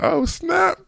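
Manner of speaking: very high falsetto mock scream; fanboy squeal. Reviewer reenacting his reaction to seeing the costume, high-pitched squeal. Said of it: "I screamed a little bit".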